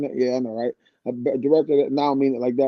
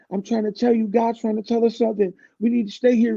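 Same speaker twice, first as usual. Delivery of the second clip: higher anxious pleading; 8 semitones higher than usual. Retold or imitated. Jason pleading to stay home.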